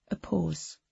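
usual speaking voice

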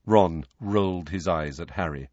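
plain reading voice

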